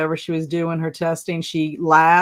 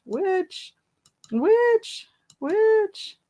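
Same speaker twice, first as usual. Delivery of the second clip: witch voice; 13 semitones higher, much slower than usual. Pretend. Playing witch after guess.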